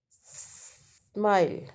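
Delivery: Smile very slow, opening S held long; syllable demonstration. Shown as a demonstration. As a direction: stretch the S of smile, then finish the word. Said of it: "तो इसमें क्या है, syllable कितने हैं". (Smile का "स" देर तक खींचकर)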